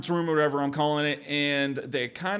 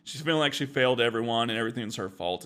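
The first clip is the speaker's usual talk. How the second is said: ordinary talk, nothing marked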